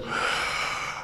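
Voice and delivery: long voiceless breath out; big exhale after coming up from underwater; deep relieved exhale. Shown as a demonstration. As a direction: show the relief of finally breathing. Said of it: "take that one deep breath".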